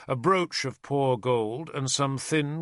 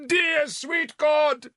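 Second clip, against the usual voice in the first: high, loud and slow wail to heaven; bellowed prayer; 5 dB louder, much slower than usual. Acted. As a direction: bellow it to the rafters. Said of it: "Sansom bellowed".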